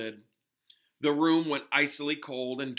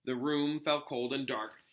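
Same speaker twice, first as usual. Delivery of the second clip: plain reading voice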